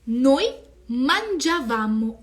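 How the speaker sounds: slow, loud teacher drill; carefully paced classroom recitation; slow model conjugation. Shown as a demonstration. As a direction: spell out each form slowly. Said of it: "E aqui é muito importante".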